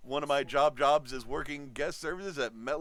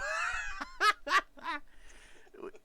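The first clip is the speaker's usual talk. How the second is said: high laugh. Real, not acted. Laughs at own schedule.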